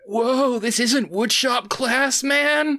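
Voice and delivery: high, slow, spaced-out stoner drawl; loud stoned surfer whoa. Retold or imitated. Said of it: "in the most stoner voice says".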